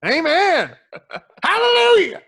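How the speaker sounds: high, loud mock gospel shout; hammed-up hallelujah like a revival preacher; preacher's amen. Closing the podcast's moral like a preacher, loud mock hallelujah.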